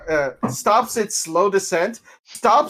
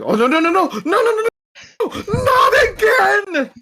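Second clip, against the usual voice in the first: panicked pleading; 13 semitones higher, faster than usual. Retold or imitated. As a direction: repeat the broom's frantic wail. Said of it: "our blue friend's going".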